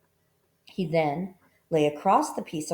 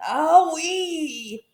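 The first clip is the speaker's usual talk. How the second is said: high yelp of pain; pained cry; 10 semitones higher, 5 dB louder than usual. A narrator's reading. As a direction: cry out as if hurt. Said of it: "cried the crocodile".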